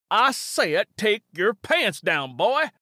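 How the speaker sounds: Jed repeating his order furiously; angry command. Acted.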